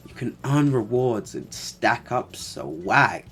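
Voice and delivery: 1 mock American accent. Reading ad in American voice.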